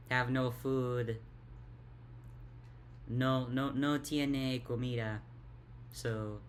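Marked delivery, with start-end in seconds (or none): none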